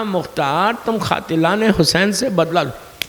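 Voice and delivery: soft, tender and quiet; lovingly. A narrator's reading. Maisam reassuring Mukhtar with love, softly and quietly. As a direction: say his name gently, like a loving promise. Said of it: "بڑی پیار سے کہا".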